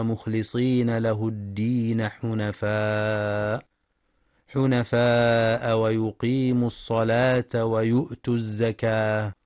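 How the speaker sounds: slow, drawn-out tajweed chant; Quran recitation. Shown as a demonstration. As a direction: recite the verse slowly, stretching the vowels. (تلاوة مجودة بطيئة)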